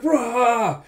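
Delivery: high, loud mock army roar; war cry. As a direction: roar back like eager troops. Retold soldiers' war cry.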